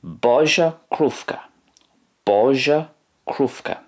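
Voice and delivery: "Boża krówka" said BO-zha KROOF-ka, slowly; careful slow Polish phrase; Polish phrase, slow and high. Shown as a demonstration. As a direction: model "Boża krówka" for learners. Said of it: "In Polish".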